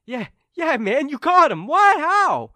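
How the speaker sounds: high, loud incredulous outburst; amazed henchman. Acted. Second Limbo member marvels at the catch, astonished. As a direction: gush in disbelief, voice way up. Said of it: "their jaws drop".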